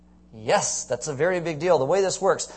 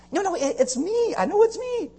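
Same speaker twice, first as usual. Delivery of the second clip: insistent healed man. Retold or imitated. Healed man insisting to neighbors, high and pleading. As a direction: plead that it's really you.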